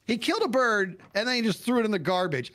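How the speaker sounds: high-pitched tattletale outburst; blurted tattle. Retold or imitated. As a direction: blurt it out like a kid telling on someone. Retold blurting tattle to mother.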